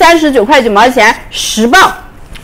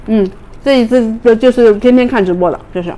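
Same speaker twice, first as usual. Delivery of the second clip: chewing while talking; talking between bites; mouth full of corn; 3 dB quieter than usual. Real, not acted. Seen 3: bites corn at 1.6-2.0, then talks, likely chewing.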